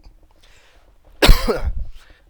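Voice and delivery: sharp real cough; loud cough. Real, not acted. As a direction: cough hard. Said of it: "I'm really sorry about the coughing".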